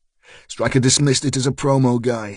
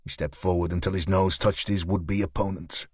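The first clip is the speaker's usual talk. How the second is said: usual speaking voice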